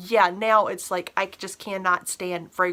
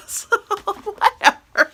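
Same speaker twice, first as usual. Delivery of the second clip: helpless laughter breaking up the words; cracking up; 11 semitones higher, 6 dB louder, much slower than usual. Real, not acted. Reviewer gives up explaining her made-up word, laughing. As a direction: squeeze the words out through real laughter.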